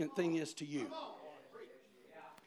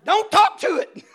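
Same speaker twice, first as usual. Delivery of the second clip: much higher, loud, laughing panicky yelp; scared little brother; 19 dB louder, much slower than usual. Retold or imitated. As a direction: imitate a terrified kid brother. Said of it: "And he goes".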